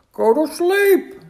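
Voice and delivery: slow, high, loud outcry; shouted. A narrator's reading. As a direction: shout it in astonished excitement. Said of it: "shouted Toad".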